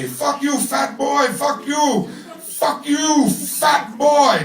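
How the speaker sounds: high mock yell, words slammed out. Retelling the coworker screaming insults, high mock yell. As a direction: parrot the guy screaming from the van, pitched high. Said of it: "he's yelling at me".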